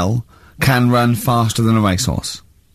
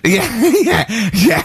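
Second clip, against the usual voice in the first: laughing; 11 semitones higher than usual. Real, not acted.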